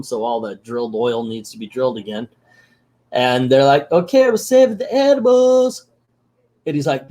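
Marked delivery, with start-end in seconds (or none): 3.9-5.9 high, hammed-up cartoon kid voice; mock eager kids. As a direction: answer like obedient cartoon children, high-pitched. Kids' reply, voiced high.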